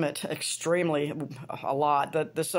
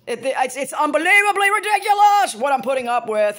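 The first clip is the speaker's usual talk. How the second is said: exasperated client; 5 semitones higher, 6 dB louder than usual. Retold or imitated. Client venting to his hairdresser, building exasperation. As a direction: vent it, building into an outburst. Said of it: "he's like".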